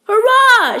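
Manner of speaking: high loud cheer; joyful shout. A narrator's reading. Jack cheers on hearing the hut is near. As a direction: cheer for sheer joy. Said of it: "shouted Jack".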